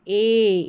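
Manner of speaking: ए stretched out long; long, sustained ए; drawn-out long vowel. Shown as a demonstration. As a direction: hold the ए, pulling it out. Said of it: "इस तरीके खींच कर बोलना है".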